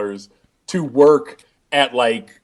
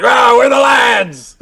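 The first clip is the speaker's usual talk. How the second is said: high, slow shouted catchphrase; Wade Barrett shout; 10 semitones higher, 5 dB louder, slower than usual. Retold or imitated. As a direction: bellow it like a wrestler. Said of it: "Barrett was just like".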